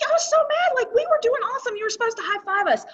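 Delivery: high, fast, indignant retelling; mock-mad outburst, quick and high-pitched; imitating a fired-up colleague. Colleague recalling his old grudge to the boss, fired up in imitation. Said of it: "I can hear it in his voice".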